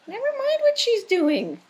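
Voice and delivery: slow, loud, high coaxing; coaxing call. Real, not acted. Urging the cat to ignore his sister, slow loud coax. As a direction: draw it out loud to keep him eating.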